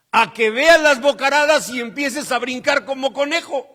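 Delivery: loud, high-pitched climax; comic exaggeration. Preacher picturing himself hopping at the smoke, comic exaggeration. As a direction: build the absurd image at full voice.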